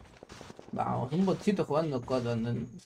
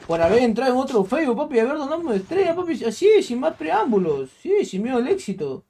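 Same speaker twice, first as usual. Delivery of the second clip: high, fast, excited thanks; loud, high-pitched streamer hype; hyped shout-out; 7 semitones higher, 5 dB louder than usual. Real, not acted. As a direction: gush thanks like a hype man.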